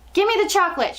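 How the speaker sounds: put-on high, demanding boy's shout. A narrator's reading. Bruno, suddenly suspicious, to the witch, shouting. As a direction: shout it as a petulant boy. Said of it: "shouted Bruno".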